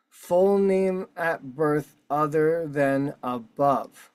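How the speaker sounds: slowly stretched-out re-reading, word by word; slow, deliberate emphasis. Real, not acted. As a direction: spell out every word for significance. Deliberate emphatic re-reading of form field.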